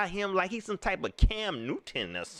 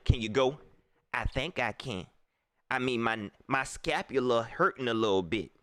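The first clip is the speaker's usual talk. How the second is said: low mock player voice; 7 semitones lower than usual. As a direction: play the injured QB asking to go. Acts coach and injured quarterback.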